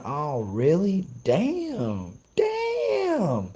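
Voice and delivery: high, slow mock amazement; fake-impressed teasing, voice soaring up; sarcastic drawn-out "Damn" in falsetto; mock surprise. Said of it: "I was in the chat saying".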